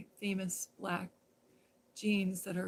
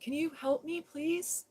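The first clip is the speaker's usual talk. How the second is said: higher, small pleading voice; 8 semitones higher, slower than usual. Retold or imitated. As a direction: ask for help meekly. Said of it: "and I go".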